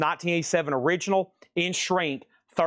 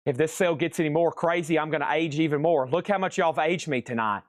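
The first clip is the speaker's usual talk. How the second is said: ordinary talk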